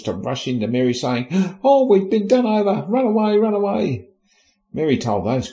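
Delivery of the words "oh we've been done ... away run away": high, loud, hammed-up alarm; mock panic. Soldiers rushing in to warn Mary, mock panic.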